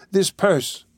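high, urgent cry. A narrator's reading. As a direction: call out urgently, pitched high. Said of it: "cried the young lady".

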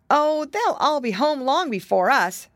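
high-pitched storybook piglet; piglet boy. Acted. Curly to his brother on the way home, high piglet voice.

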